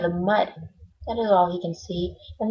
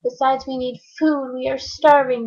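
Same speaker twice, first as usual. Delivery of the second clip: higher, pleading little turtle; higher, mild groaning plea from Mac; 4 semitones higher than usual. Acted. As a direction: complain politely but in pain. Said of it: "groaned Mac".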